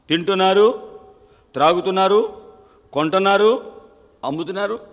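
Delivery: rising pulpit list; preaching. Acted. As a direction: build each word upward.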